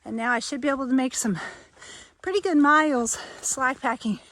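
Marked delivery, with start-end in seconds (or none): 2.2-4.2 excited. Real, not acted. Nearly at the new state.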